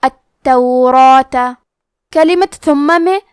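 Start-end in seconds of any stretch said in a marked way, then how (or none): 0.0-1.4 التوراۃ drawn out very slowly, the doubled تا held and the final زبر sounded clearly; التوراۃ recited slowly and deliberately with a full, heavy را and a long alif; التوراۃ with every vowel given its full length; tajweed demo. Shown as a demonstration. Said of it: "ہمیں زبر کو ظاہر کرنا ہے".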